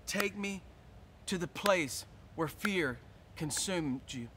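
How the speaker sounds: tender invitation to open up; intimate preaching. Acted. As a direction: draw them in gently, almost confiding. Slow soft intimate preaching.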